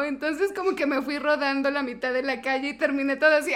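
ordinary delivery

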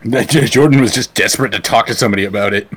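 normal talking voice

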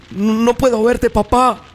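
put-on high, loud, slow frightened child's cry. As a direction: plead through tears. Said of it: "Lloraba el niño". (niño llorando asustado)

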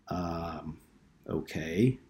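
a quiet, halting um, then a doubtful okay; stunned and unsure it heard right; disbelief. A narrator's reading. Told something impossible, a quiet stunned um-okay. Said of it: "he said in disbelief".

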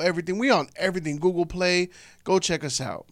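upbeat podcast sign-off read; show outro plug. Acted. Host plugs the podcast to listeners, upbeat announcer read. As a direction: hype up the listeners to follow the show.

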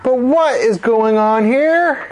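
high, slow, disbelieving demand; baffled librarian challenging the scene; indignant question. Acted. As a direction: demand an explanation, stretching the words.

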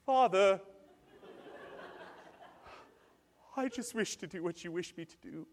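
put-on sweet child voice; adoring child. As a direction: play an angelic obedient kid. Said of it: "then your child".

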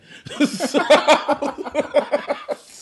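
long, high, loud laughing fit; hearty laugh. Real, not acted. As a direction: crack up completely before going on. Cracks up before resuming the story.

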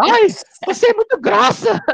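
high falsetto, offended lady; man doing a woman's voice. Retold or imitated. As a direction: squeal it like an insulted woman. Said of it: "Aí ela".

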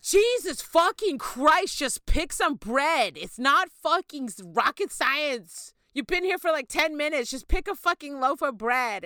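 loud, high, slowed exasperated rant; hammed-up fury at a dithering partner; exasperated yelling. Pretend fight over bread, exasperated yelling at partner.

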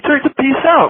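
high, emphatic imitated controller; insistent repeat. Controller repeating the clearance to a baffled pilot, insistent.

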